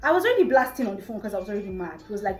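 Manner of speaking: ordinary talk, nothing marked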